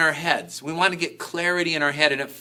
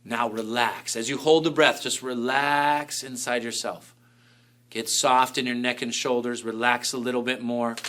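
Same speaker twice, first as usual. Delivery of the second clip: soothing guidance; 3 semitones lower than usual. Shown as a demonstration. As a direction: settle them into stillness. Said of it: "guiding relaxation while holding breath".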